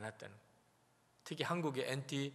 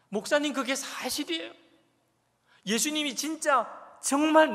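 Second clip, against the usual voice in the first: much higher, louder pleading shout; imagined shouting; 11 semitones higher, 12 dB louder than usual. Retold or imitated. Congregation silently shouting at their pastor, loud and high. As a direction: cry out your doubt at the pastor. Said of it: "마음으로는 이렇게 소리를 지르고 있겠구나".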